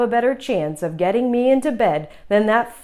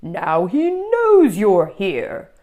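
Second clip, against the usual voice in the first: slow, louder weary monster voice; sighing monster; 6 dB louder, slower than usual. Acted. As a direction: sigh it out, exasperated. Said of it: "the voice sighed".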